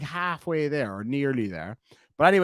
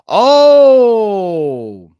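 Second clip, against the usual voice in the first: a sudden loud yelp of surprise; startled exclamation, loud and sharp; the penny drops with a loud oh; loud surprised oh; 5 semitones higher, 14 dB louder than usual. Real, not acted. Reacts to a cheeky comment.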